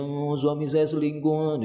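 slow put-on wail; imitating a weepy grievance; mock tearful complaint. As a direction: whine to a friend through pretend tears. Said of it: "Dia ngadu-ngadu ke manusia".

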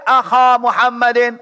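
"Akha Muhammadin" with long a ending Akha; alif reading. Shown as a demonstration. Teacher asking why not alif, "Akha" with long a. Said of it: "Kenapa nggak pakai alif?".

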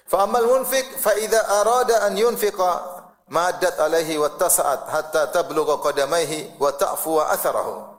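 chanted Arabic quote; hadith recitation. Acted. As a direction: recite the hadith text with weight.

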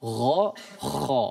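ghain and kha demonstrated; "Gha, kha" as a throaty voiced gha, then a rasped kha; letter sounds demo. Shown as a demonstration. Teacher demonstrating the two throat letters. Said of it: "Kok beda suaranya?".

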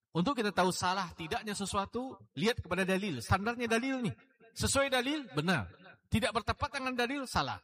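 impassioned preacher pressing the congregation; fiery preaching. Acted. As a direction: drive the point home from the pulpit. Preaching at full force.

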